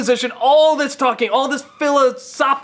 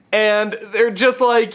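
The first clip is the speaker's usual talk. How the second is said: normal talking voice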